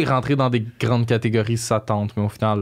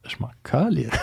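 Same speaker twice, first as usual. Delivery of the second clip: whispered through a laugh; breathy laugh, half whispered; 5 semitones higher than usual. Real, not acted. Dismissive aside about being boring.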